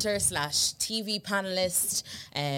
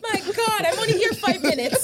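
high, louder laughing protest; 6 dB louder than usual. Real, not acted. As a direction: laugh it off in mock outrage. Mock outrage at teasing.

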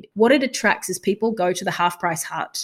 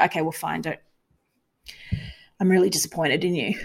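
mock gruff; 2 semitones lower, faster than usual. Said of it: "being quite gruff".

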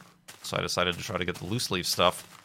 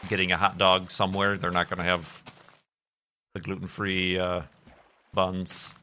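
ordinary delivery

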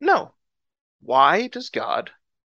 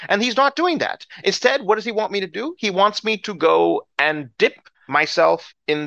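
higher-pitched incredulous rant; indignant, exasperated protest; angry Naaman; 5 semitones higher than usual. Acted. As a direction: complain in acted outrage, question after question. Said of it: "he gets up extremely angry".

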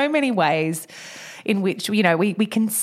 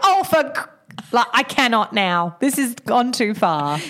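slow mock exasperation; slower than usual. Mum fed up, retold.

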